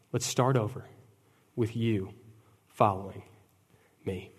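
the preacher softly echoing Jesus; gentle invitation. Acted. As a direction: call them back tenderly. Repeated tender invitation.

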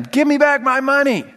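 high, loud shout for money; acted yell. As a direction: yell it at him like a furious creditor. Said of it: "yelling at him".

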